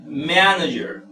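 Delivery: manager, strong first syllable, falling tail; stress on first syllable. Shown as a demonstration. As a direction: lean on the start of manager. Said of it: "mayor fuerza de voz está en ma".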